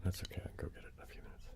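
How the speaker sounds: fast unvoiced whispered aside; quick whisper. Real, not acted. Whispering an aside to viewers about the crinkle bag. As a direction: whisper it quickly, half to yourself.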